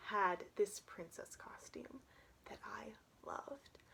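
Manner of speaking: soft unvoiced whisper; confiding whisper. Real, not acted. Telling listeners of her loved costume, whispered. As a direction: share it like a secret.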